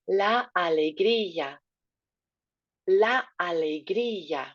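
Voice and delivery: alegría with the í clearly stressed, slow. Shown as a demonstration. Teacher models alegría for class, stressed í. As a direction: lean on the í in alegría. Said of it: "Nice accent up on the I".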